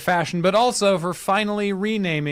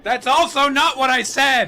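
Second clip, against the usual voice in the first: loud, high correction; exasperated shout; 8 semitones higher, 4 dB louder than usual. Acted.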